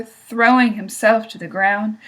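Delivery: plain reading voice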